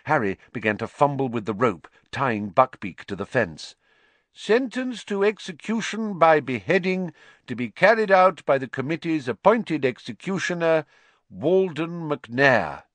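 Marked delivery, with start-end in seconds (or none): 4.3-12.8 higher, formal read-out voice; official proclamation. Acted. Committee official reading out an execution sentence, formal proclamation.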